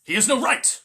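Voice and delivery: loud angry protest; a furious wolf father bursts out; angry outburst. Acted. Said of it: "Father Wolf began angrily".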